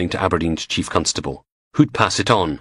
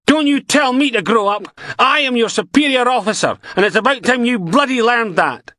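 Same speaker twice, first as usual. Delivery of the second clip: much higher, louder furious yell; angry yell; 4 dB louder than usual. Acted. DI yelling at Logan, pulling rank. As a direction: pull rank at full volume.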